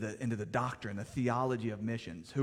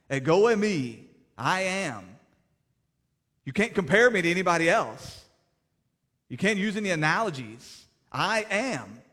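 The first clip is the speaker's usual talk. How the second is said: louder, higher pulpit proclamation; preaching at full force; 5 semitones higher, 8 dB louder than usual. Acted. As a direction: drive the truth home from the pulpit.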